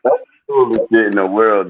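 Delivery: ordinary talk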